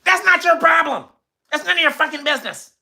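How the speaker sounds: high, fast mock woman snapping. Woman telling the man it's none of his business, high and snappy. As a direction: bite back high and fast.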